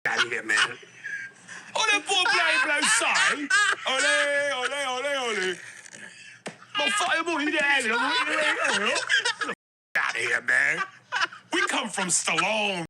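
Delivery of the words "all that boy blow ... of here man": high, hammed-up cockney rant; mock English hard man, laughing through it; mock British tough-guy accent. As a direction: ham up a London thug chant. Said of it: "nothing macho to me about".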